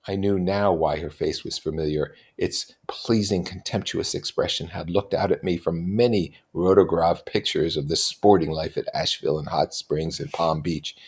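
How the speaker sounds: ordinary delivery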